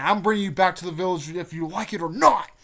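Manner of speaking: hammed-up hero yelling his promise; imitated determined shout. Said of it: "He's like, he's like".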